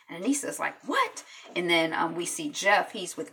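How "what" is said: shocked what. Retold or imitated. Anissa reacts to dealer's insult.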